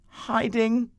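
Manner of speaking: high timid little reply; Adam admitting to God he is hiding; voicing Adam. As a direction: answer meekly, caught out.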